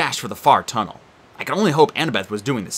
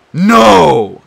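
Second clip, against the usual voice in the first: shouting; 5 semitones higher, 13 dB louder than usual. Acted. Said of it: "Tyson charged".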